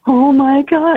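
high-pitched, drawn-out mock horror; imitated shock. Mother imitates her sister walking in on labour, shocked. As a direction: imitate a stunned onlooker, voice leaping up.

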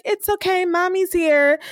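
mock soothing mommy. Serena soothing the crying baby.

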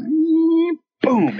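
high charging whine into a boom; imitated defibrillator shock. Patient retells the second shock, imitated whine and boom. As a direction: mimic a flash charging, then firing. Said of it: "and it goes".

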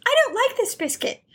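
put-on high little girl's whiny complaint. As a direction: pitch up high like a fussy small child. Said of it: "the little girl goes".

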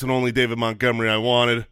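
ordinary talk

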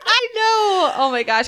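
high laughing I know; laughing agreement. Real, not acted. Cohost laughing along, high and loud.

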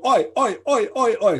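high, repeated terrace chant of "oi"; Cockney football fans. Retold or imitated. Aggressive football fans in England, imitated Cockney chanting. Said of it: "aggressive Cockney football fans".

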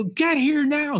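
high-pitched imitation of an urgent call; retold insistent holler; urgent summons. Retelling friends urgently summoning him.

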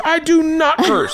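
very high, drawn-out mock outrage; indignant denial. Accused daughter denying it to dad, indignant. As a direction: imitate an affronted kid, slowly word by word.

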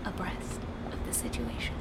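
breathy innuendo whisper. Pretend. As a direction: whisper it suggestively. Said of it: "That was an innuendo".

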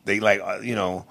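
usual speaking voice

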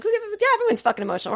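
high, rushed, exasperated comeback; indignant rebuttal, high and quick; exasperated retort. Acted. Acted exasperated retort to sexist remark.

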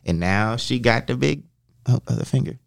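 usual speaking voice

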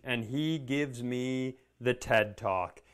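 usual speaking voice

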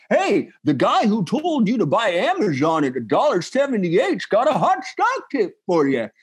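lightly voiced huckster ad; a hint of a salesy pitch; ad pitchman voice. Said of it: "ads that are like".

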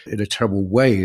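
normal talking voice

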